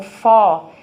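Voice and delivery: "fó" with a loud, open O; open vowel. Shown as a demonstration. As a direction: sound out "fó" with an open O. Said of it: "o ó é aberto".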